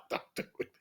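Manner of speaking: hushed "Don't do it"; whispered warning. Retold or imitated. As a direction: warn under your breath. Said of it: "she's like".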